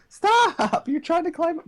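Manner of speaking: very high squealed protest; high-pitched pet-talk scolding; playful puppy scolding. Real, not acted. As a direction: squeal at a puppy climbing on you.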